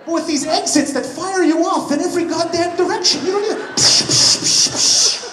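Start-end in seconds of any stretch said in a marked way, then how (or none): 3.8-5.3 loud "psh" bursts, sharp and airy; whooshing cars. Retold or imitated. Comic showing a roundabout flinging cars out, loud imitated whooshes. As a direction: fire off rapid whooshes with the mouth.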